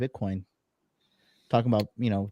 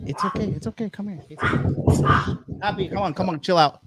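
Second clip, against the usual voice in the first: soothing the dog; 4 semitones higher, faster than usual. Real, not acted. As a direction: coax the frightened dog to chill. Soothing dog named Happy.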